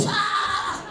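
loud, high comic scream; mock scream. Drunk hit by his own spray, mock screaming. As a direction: burst out shrieking.

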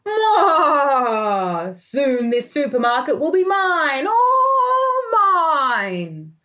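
gleeful evil pea with a sung gloat; villain gloat. Acted. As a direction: cackle and gloat over the supermarket.

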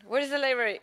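loud, high call for directions; mock shouting. Player stopping someone in the street, shouting. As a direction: holler it at someone you just stopped. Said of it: "you're just shouting".

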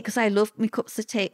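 normal talking voice

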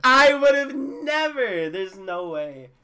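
slow, loud, stunned disbelief; disbelieving. Real, not acted. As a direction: stretch it out in shock. Disbelief at game rule.

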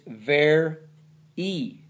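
"ver E", split before a separate ee; long E ending. Shown as a demonstration. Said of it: "It's not ver Y".

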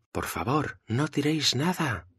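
high, pleading call to a crowd; urgent exclamation. A narrator's reading. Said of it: "exclama Marisol". (exclamación aguda y suplicante)

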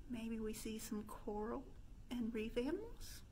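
usual speaking voice

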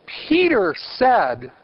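Peter said slow, Peter high and loud; slow stress. Shown as a demonstration. Teacher quoting the tome of Leo, slow stressed Peter. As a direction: draw out Peter said with weight. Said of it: "Guess how the tome of Leo begins".

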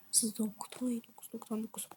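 very soft whispered counting; soft whispered countdown to herself. Real, not acted. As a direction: breathe the numbers under your breath. Counting down under her breath.

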